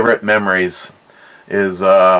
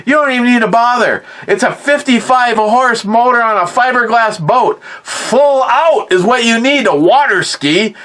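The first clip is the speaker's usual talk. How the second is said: fired-up grandpa; 12 semitones higher than usual. Retold or imitated. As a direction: brush off the complaint in one rushing breath. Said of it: "He goes".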